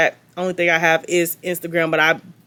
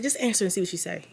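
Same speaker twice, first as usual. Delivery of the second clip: whisper; 9 dB quieter, faster than usual. Retold or imitated. Whispering to him in the Uber.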